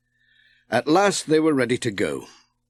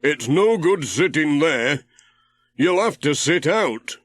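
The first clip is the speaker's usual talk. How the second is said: Ted, voiced higher; 4 semitones higher than usual. A narrator's reading. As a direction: call to Pat from the boat, curious. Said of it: "said Ted".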